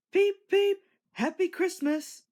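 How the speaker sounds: high, loud whistling peep; cheerful whistle. A narrator's reading. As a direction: toot it out like a happy engine. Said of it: "whistled Thomas".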